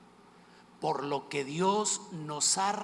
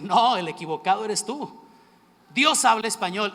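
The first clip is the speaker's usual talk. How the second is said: réplica celosa; 3 semitones higher, 8 dB louder than usual. Retold or imitated. Réplica celosa, más fuerte.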